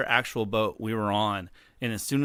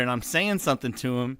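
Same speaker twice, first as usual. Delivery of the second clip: ordinary talk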